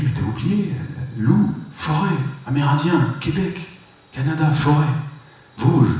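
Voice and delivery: petite voix aiguë d'enfant, plus haute; un petit garçon qui crie. Retold or imitated. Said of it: "la petite voix toute aiguë que j'avais quand j'étais gamin". (l'enfant intérieur lui crie avec une voix aiguë)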